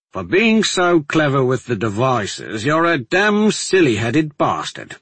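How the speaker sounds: loud, emphatic friendly insult; teasing guardsman. Acted. Guardsman chiding his clever alchemist friend, teasing. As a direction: rib a friend in a soldier's voice. Voices Jaiska after a laugh.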